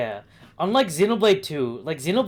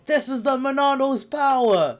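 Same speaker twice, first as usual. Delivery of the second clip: raised, heroic game quote; hero catchphrase voice; 9 semitones higher than usual. Acted.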